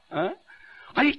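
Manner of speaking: ordinary talk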